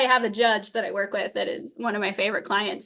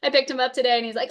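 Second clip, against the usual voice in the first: normal talking voice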